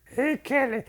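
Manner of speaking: high squeaky voice for Frenchie; lightly hammed-up silly caricature voice; high put-on voice. Dubbing Frenchie as he talks to Kelly, in a high squeaky voice.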